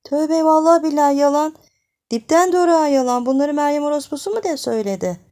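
high, loud indignant protest; outraged denial. Acted. Accused woman fiercely denies the gossip, outraged and loud. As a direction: swear it's a lie, furious.

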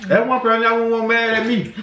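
high, loud outburst. Real, not acted. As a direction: blurt it out worked up. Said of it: "I'm sorry I got loud".

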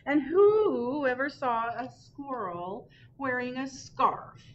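great horned owl hooting loudly at Earl. Acted. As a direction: hoot it out like an indignant owl. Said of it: "hooted the great horned owl loudly".

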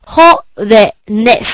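"jovenes" slowly split as jo, ve, nes; syllable by syllable. Shown as a demonstration. Teacher counts syllables for students, "jovenes" broken into three beats. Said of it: "有三个音节".

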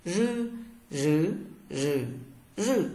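"Rư, rứ, rừ, rừ" with curled tongue and buzzing vocal cords; voiced retroflex r. Shown as a demonstration. Teacher models r for learners, "Rư, rứ, rừ, rừ" voiced with curled tongue. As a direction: say "Rư, rứ, rừ, rừ" as buzzed Mandarin r through four tones. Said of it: "R cong lưỡi và dây thanh rung nhé".

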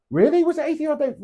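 high, startled disbelief; really, was it?; surprised. Real, not acted. Doubting his own checkout claim.

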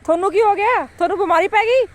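high, loud pet talk. Real, not acted. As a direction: fuss over the dog. Talking to the dog.

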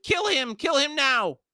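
frantic high-pitched shout; Pormqual. A narrator's reading. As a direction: order it in a panicked screech. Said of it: "jabbed a finger at Duiker and shouted".

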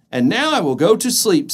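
high, cheerful little mouse. Acted. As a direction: show it off brightly. Mouse speaks.

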